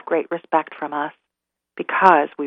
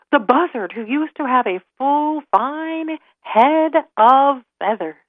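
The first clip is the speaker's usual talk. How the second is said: plain reading voice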